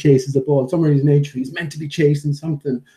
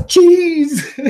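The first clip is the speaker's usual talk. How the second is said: very high, loud excited pup; dog's voice; 6 dB louder than usual. Acted. Owner voicing the dog discovering cheese, loud high delight. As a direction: squeal it like a thrilled dog. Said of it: "And he goes".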